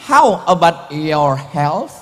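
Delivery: slow English-accented How about your health?. Shown as a demonstration. (logat Inggris pelan)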